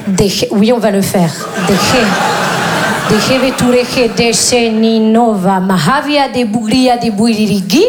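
mock Spanish gibberish, laughing; hammed-up fake Spanish accent. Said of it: "Attention à ne pas confondre avec l'espagnol". (faux accent espagnol charabia)

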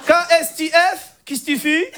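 put-on high-pitched Moroccan accent; comic Moroccan-accented French; Hicham. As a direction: spell it out in a thick Moroccan accent. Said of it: "l'accent arabe".

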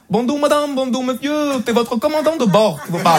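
high-pitched mock Chinese accent. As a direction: announce like a pilot with a Chinese accent. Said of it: "l'accent chinois m'a vraiment fait peur". (annonce de commandant, accent chinois caricatural)

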